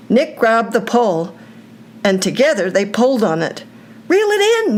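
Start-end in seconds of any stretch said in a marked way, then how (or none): 4.1-4.8 high, pushed shout; urgent shout. A narrator's reading. Nick shouting at Brigham to reel in, urgent shout. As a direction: yell the order across to your friend. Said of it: "Nick shouted at Brigham".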